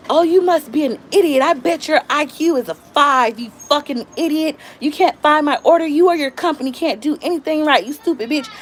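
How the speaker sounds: high-pitched mock tirade at the agent; imitated irate caller. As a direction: play a customer cussing someone out. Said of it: "they cuss me out".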